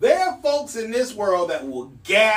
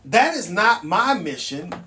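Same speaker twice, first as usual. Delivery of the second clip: usual speaking voice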